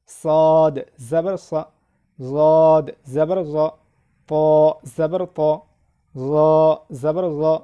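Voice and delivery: measured, drawn-out letter names, short syllables; spelling drill recitation. Shown as a demonstration. Teacher leading learners through zabar, drill recitation. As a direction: recite letter by letter in a steady rhythm.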